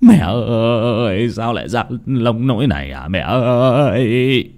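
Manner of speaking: long, slow, quavering wail; bawling lament. A narrator's reading. Old son lamenting to his dead mother, bawling. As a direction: wail it, dragging out each call. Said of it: "Lão Tính khóc giống lên".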